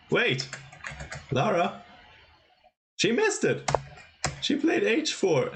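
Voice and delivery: high-pitched startled exclamations; shocked disbelief. Real, not acted. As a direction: cry out as you spot the blunder. Commentator spots a missed move.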